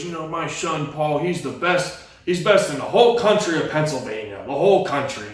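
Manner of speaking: a hint of a proud drunk dad bragging. Drunk dad bragging about his son to the uncle, sloppy and proud. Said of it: "My dad's hammered drunk, and he goes".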